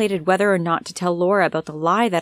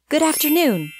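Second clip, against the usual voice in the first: higher, courteous greeting; polite; 9 semitones higher than usual. A narrator's reading. Newcomer greeting the stern butler, polite. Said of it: "I said politely".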